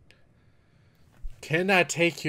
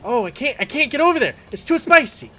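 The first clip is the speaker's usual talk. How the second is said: higher, louder panic, can't reach the spot; frantic yelping as the fight turns; panicked; 12 semitones higher, 13 dB louder than usual. Real, not acted. Gamer losing a hard boss fight, panicked yelling.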